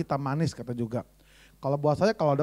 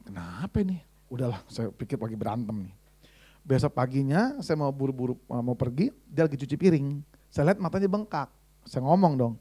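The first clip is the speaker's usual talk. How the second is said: ordinary talk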